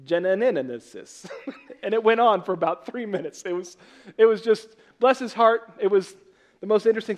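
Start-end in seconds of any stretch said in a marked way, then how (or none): 0.0-1.3 Genesis dragged out slowly with extra syllables; mock mispronunciation of Genesis, slow and garbled; mangled Genesis. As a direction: stumble badly through Genesis like a struggling reader. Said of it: "could not pronounce Genesis".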